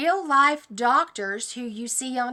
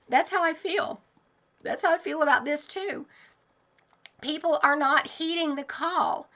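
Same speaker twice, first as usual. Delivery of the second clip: ordinary delivery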